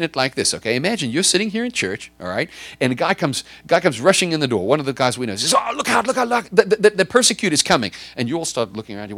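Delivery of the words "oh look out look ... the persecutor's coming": alarmed warning. Acted. Congregant warns of the persecutor.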